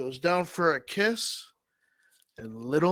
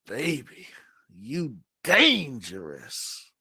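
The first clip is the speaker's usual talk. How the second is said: slow, louder smooth-talker drawl; Omar impressed; 6 dB louder, slower than usual. Acted. Omar eyeing Olga's open robe, slow and loud. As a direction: stretch it out like a ladies' man. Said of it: "Omar goes".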